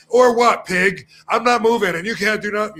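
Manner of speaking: high, loud taunting challenge; defiant mock shout. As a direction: throw it in the cop's face, mocking. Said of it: "he's more like that".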